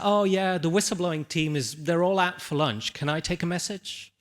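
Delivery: high-pitched, casual phone answerer; receptionist. Retold or imitated. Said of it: "I'm the receptionist".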